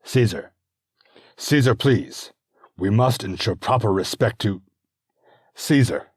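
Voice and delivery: pleading senator calling out; calling out to Caesar. Acted. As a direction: try to be heard over the crowd. Olympius pleads over crowd.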